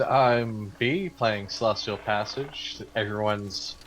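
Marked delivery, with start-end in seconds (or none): none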